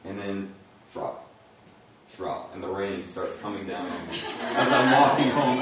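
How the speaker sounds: ordinary talk, nothing marked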